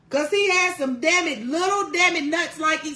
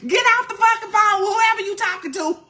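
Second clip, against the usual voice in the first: louder, insistent hang up demand; mock urgent yelling; 5 semitones higher, 3 dB louder than usual. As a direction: shout at her to drop the other call. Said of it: "call friend, demand they hang up".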